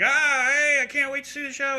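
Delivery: high-pitched imitation of an eager drunk; drunk fan. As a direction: put on an excitable drunk guy's voice. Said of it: "And the guy's drunk".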